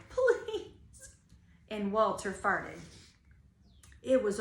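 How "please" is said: put-on high begging children's plea; pleading kids. A narrator's reading. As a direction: beg like a desperate child. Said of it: "They pleaded".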